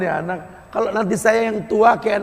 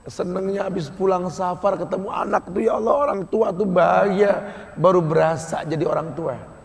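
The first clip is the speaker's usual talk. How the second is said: normal talking voice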